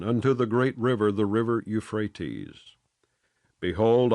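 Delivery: usual speaking voice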